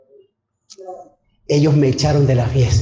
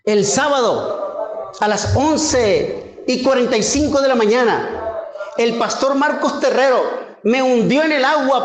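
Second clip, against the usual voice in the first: higher, rising preacher's fervour; fervent preaching; 8 semitones higher than usual. Acted. Preacher exhorting the congregation, raised fervent voice. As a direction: preach it to the congregation with mounting conviction. Preaching his baptism testimony.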